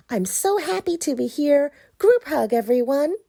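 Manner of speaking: high, bright and bubbly character; bouncy happy pumpkin; cheerful pumpkin voice. Acted. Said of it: "I'm Christopher Pumpkin".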